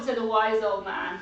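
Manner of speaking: ordinary talk, nothing marked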